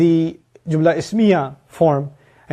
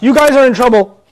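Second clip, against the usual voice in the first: loud, raised warning to a group; scolding teacher; 8 semitones higher, 7 dB louder than usual. Acted. Teacher warns the whole class, loud and scolding. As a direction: call out the whole room sternly. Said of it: "if I tell my class".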